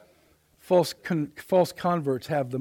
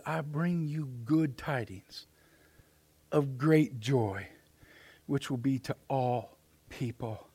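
hushed reverent quote; 2 semitones lower, 5 dB quieter, slower than usual. Acted. Preacher quoting the angel's good tidings, hushed. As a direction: let the promise land softly, with pauses.

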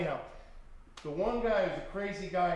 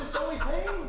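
laughing; 12 semitones higher, 5 dB louder than usual. Retold or imitated. Laughing at his own retort.

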